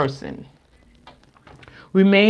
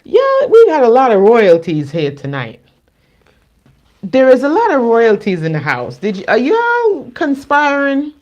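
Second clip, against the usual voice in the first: excited; 7 semitones higher, 6 dB louder than usual. Real, not acted. Excited card reveal to viewers.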